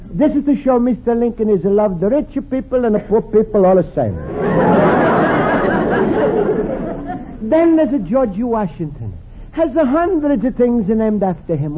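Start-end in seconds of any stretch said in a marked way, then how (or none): 4.2-7.4 breathy chuckle. Acted. Luigi laughs at his own joke.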